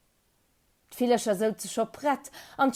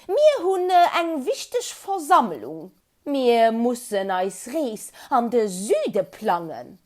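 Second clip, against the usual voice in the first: high, loud chirping bird voice; a small bird peeping back down from the tree; squeaky birdlike answer; chirpy little bird; 5 semitones higher, 8 dB louder, slower than usual. A narrator's reading. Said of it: "zurückgepiepst".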